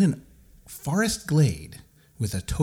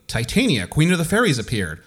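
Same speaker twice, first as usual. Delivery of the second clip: usual speaking voice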